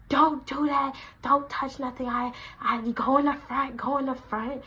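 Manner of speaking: snapping with attitude. Retold or imitated. Manager ordering worker out of the kitchen, with attitude. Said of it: "She was catching an attitude with me".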